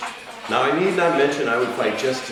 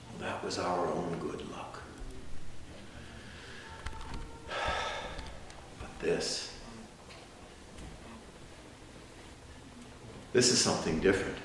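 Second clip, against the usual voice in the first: low, hushed, long pauses; soft and sombre; grave reflection; 4 semitones lower, 13 dB quieter than usual. Acted. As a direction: sink to a quiet, weighty turn. Grave turn in courtroom speech.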